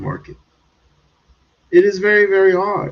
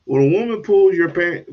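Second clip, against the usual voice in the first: ordinary delivery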